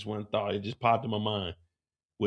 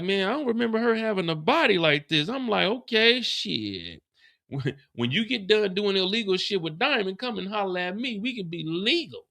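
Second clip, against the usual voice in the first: flirting voice; 7 semitones higher than usual. Retold or imitated. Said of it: "I was like".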